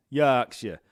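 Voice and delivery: "Yorkshire" in broad Yorkshire, opened out to "Yaakshire"; broad Yorkshire accent. Shown as a demonstration. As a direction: punch "Yorkshire" with a broad Yorkshire vowel. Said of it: "We talk like this up there".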